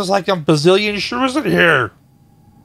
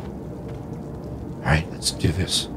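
whispered self pep talk; hushed, under the breath; 11 dB quieter, much slower than usual. Real, not acted. Quiet hype-up to self.

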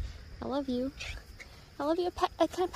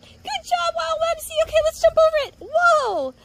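much higher, delighted cheering; excited praise; 10 semitones higher, 6 dB louder than usual. Real, not acted. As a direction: celebrate the dog's jump. Praising dog after trick.

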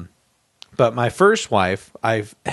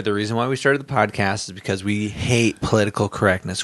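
usual speaking voice

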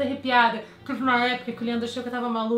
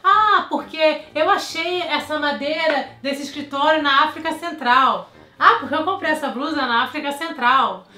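mock bragging; 4 semitones higher, 9 dB louder than usual. Vlogger jokingly boasting to friends, mock showing off. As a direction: show off playfully about the Central African origin. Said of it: "tipo zoando".

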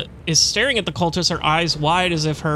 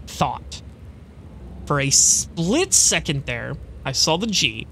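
ordinary talk

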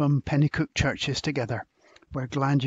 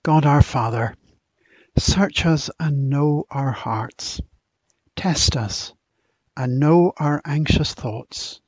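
public prayer; 5 dB louder than usual. Acted. Minister leading the congregation in prayer, earnest and raised. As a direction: lift each petition up to God for the gathered church.

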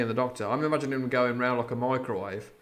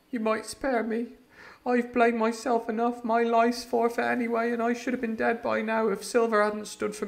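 put-on higher boy's voice, pleading, growing louder; tearful boy; 10 semitones higher, 7 dB louder than usual. Jim begs the doctor, higher boyish voice near tears. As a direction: beg as a frightened lad near tears. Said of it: "I began to weep".

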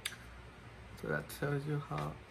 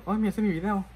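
mock excited; 5 semitones higher, 8 dB louder, much faster than usual. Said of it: "le digo".

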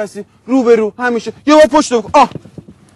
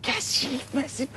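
very soft, slow mocking echo; hushed, slow brush-off; dismissive echo; 15 dB quieter, slower than usual. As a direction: toss it back almost whispered. Mockingly echoes complaint about mess.

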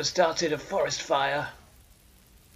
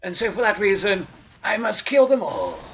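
louder declaration; 6 semitones higher, 9 dB louder than usual. Acted. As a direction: raise it, menacing. Vows to kill them all.

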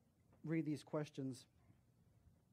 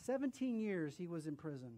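ordinary talk

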